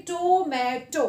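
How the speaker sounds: "tomato" slowly, each open vowel lengthened; longer vowel sound. Shown as a demonstration. Teacher contrasting open syllables for children, long vowels in "tomato". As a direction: hold every vowel in "tomato". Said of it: "we produce a longer sound".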